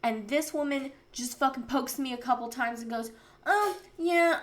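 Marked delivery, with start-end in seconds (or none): 3.5-4.4 mocking doctor.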